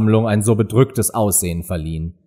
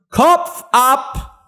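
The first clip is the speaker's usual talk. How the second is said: böse Königin; 13 semitones higher than usual. A narrator's reading. Königin befiehlt Hinrichtung.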